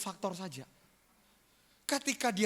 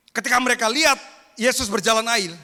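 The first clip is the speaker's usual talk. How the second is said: loud, high preaching cry; preaching at full force; 5 semitones higher, 12 dB louder, faster than usual. Real, not acted. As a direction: belt it out to the whole church. Preacher building to climax. (seruan khotbah yang lantang)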